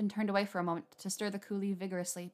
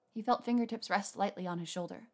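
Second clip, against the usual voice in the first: plain reading voice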